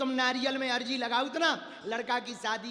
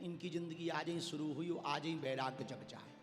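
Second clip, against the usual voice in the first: low, soft aside; hushed aside; 4 semitones lower, 9 dB quieter than usual. Real, not acted. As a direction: drop the voice as if confiding. Joking aside about the son-in-law. (धीमी, नीची आवाज़ में टिप्पणी)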